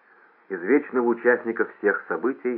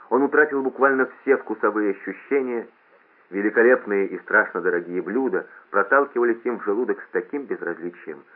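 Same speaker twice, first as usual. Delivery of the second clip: ordinary delivery, nothing marked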